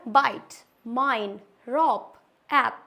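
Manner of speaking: बाइट, माइन, रॉप, ऐप said slowly with long vowels; slow model list with long vowels; model words. Shown as a demonstration. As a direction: carefully model each word, long vowel, silent e. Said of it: "ये क्या है हमारा".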